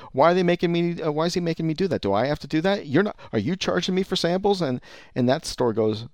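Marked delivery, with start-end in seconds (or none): 0.1-4.6 higher-pitched mock complaint; acted indignation. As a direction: protest the charge as a put-out customer, pitched up. Acted customer complains to competitor.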